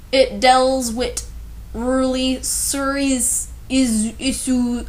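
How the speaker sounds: slow, sounding out each misspelled word as written; reading typos literally. Shown as a demonstration. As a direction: stumble through the garbled text word by word. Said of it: "oh, God".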